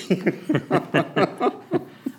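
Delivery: high, bubbling chuckle; amused laugh. Real, not acted. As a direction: laugh heartily at the question. Amused at the host's question.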